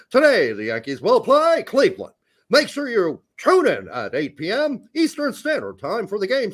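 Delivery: vintage American broadcast voice, swooping intonation; old TV announcer. Acted. Parodying American TV of decades ago, old announcer voice. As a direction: announce the ballgame like old-time television. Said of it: "you'd think Americans talk like this".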